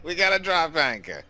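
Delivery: high, loud kvetching seasick pirate; yiddish accent. Pretend.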